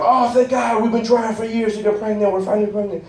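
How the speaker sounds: high, gushing mock relief; gushing relieved parent. Parents gushing over finally getting pregnant, high mock relief. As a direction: gush like a grateful couple. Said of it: "you're like".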